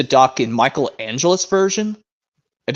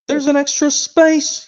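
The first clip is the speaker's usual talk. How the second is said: much higher, slow excited cry; 12 semitones higher, slower than usual. A narrator's reading. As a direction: cry it out high in surprise. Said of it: "he cried".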